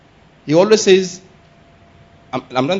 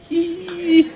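high-pitched drawn-out squeal; shocked squeal; 12 semitones higher than usual. Acted. Preacher acts out shock at a crash, high squeal. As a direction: squeal as if startled.

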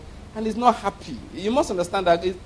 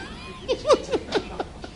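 breathy giggle, up high; high airy laugh; 7 dB quieter than usual. Real, not acted. Preacher laughing at the Dangote joke, airy and high. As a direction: let out a soft high laugh.

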